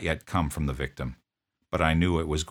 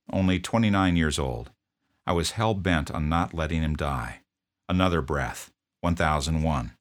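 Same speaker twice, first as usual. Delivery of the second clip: plain reading voice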